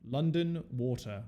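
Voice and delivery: slow, measured London accent; London English accent. Shown as a demonstration. English friend teaching a classic phrase, London accent. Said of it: "That's the classic".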